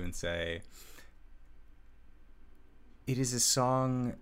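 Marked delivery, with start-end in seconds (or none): 3.1-4.2 awed elf smith talking to herself; elf smith Marissa, in awe. Acted.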